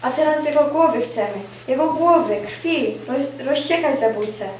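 loud, high cry for revenge; angry avenger rousing a mob; vengeful demand. Acted. Avenger demands the killer's head.

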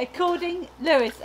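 ordinary delivery

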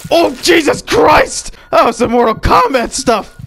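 high, loud amazed outburst; startled shout. Real, not acted. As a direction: yell in shock at something gory. Startled by gory game moment.

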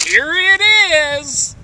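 high, loud, drawn-out delight; excited squeal. Acted. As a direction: squeal it with joy. Girl exclaims with delight.

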